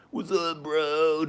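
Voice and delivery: high, drawn-out bro greeting; California stoner bro. Pretend. Imagining greeting the downstairs neighbors, stoner bro voice. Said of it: "Think about if I had been like".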